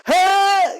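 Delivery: sharp high yelp; sudden shout. Pretend. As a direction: blurt out a startled cry. Pretends to hack the burger.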